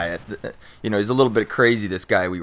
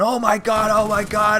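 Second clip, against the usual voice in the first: high, frantic repeated cry; panicking; 10 semitones higher than usual. Retold or imitated. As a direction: gasp it out in rising panic. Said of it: "he's freaking out".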